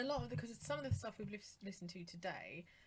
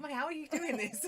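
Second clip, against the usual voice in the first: high, loud, incredulous fan; mock amazement; 8 semitones higher, 7 dB louder than usual. Fan watching a guitarist sing impossibly well, mock amazed. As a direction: react in hammed-up disbelief at a dazzling performer.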